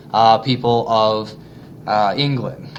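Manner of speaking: usual speaking voice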